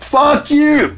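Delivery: very high loud mock scream of fuck you; furious scream. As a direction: scream it at the shark. Screaming at shark.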